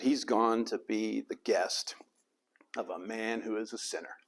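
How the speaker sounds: grumbling. Acted. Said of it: "they all grumbled".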